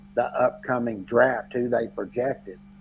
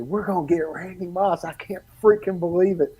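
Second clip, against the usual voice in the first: higher, thrilled shout of disbelief; excited outburst; 6 semitones higher than usual. Retold or imitated. Fan at the draft telling dad, thrilled and higher. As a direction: jump up thrilled about the pick. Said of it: "And I jumped up".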